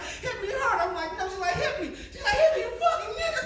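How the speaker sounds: high, laughing woman's voice; very high woman voice getting carried away; frenzied woman. Retold or imitated. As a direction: build up the wild demand.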